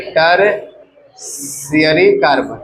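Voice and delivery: Tertiary carbon stretched slowly, each syllable held; drawn out slowly. Shown as a demonstration. (Tertiary carbon धीरे-धीरे खींचकर)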